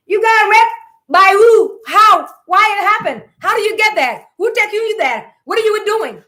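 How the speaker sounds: high, loud rapid-fire parent questions; acted interrogation. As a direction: play the parent grilling her with loud accusing questions. Parents interrogate the daughter harshly.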